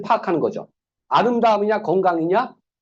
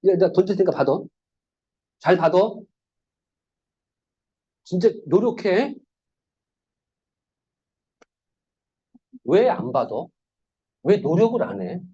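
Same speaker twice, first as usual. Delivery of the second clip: a hint of a scolding coach, barely raised; mock anger. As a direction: play an annoyed thrower blaming the catcher, lightly. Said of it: "이렇게 화를 제가 내고 있죠".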